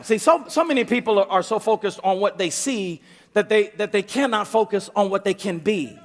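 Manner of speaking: ordinary talk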